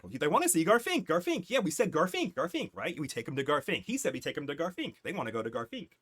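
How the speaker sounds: high, excited gabble, easing off toward the end; background chatter. Acted. Guards in the back repeating the name, excited chatter. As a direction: pipe up like overlapping eager onlookers. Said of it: "you hear in the, in the back".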